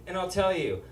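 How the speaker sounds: normal talking voice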